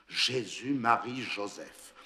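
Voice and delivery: plain reading voice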